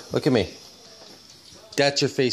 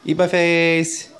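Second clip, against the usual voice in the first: slow, high, stretched call; drawn-out catchphrase; 6 semitones higher, 3 dB louder, slower than usual. Shown as a demonstration. Parent modelling a sign-off for child, slow and high. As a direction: stretch each word out high.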